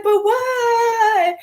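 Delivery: high, whining but why; a pouting, whimpering protest; whiny mock plea. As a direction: play the sulky kid cut off. Mock complaint at being cut off.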